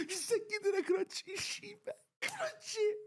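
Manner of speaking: very high, strained squeal; choked-up joy, squealed out; overwhelmed celebration. Real, not acted. Celebrating the goal in Korean.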